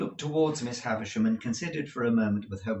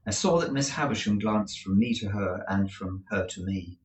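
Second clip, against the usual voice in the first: plain reading voice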